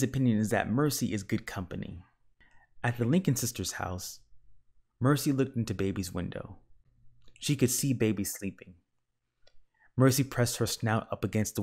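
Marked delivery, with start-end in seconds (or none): none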